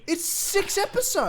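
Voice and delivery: high, slow exasperation; exasperated. Real, not acted. Fan pushing friend to watch short series, exasperated. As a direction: stretch it out in frustrated disbelief. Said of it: "I'm so angry with this".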